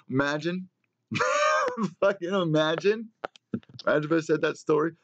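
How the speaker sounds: high squealing laugh through the words; cracking up at his own joke. Real, not acted. Host picturing his fish dead, squealing with laughter.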